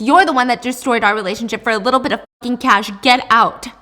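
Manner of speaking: fast, loud acted outburst; screamed accusation. Daughter throws her mom out, screamed accusation. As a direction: fire it off, then a loud dismissal. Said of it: "Diana screams".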